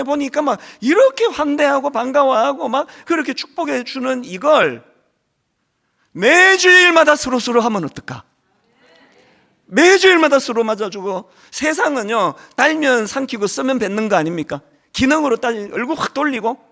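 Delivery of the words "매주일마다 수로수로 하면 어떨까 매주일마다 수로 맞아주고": fervent sermon peak; rousing call. Real, not acted. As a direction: build the vision up to the crowd. Preacher climaxing vision.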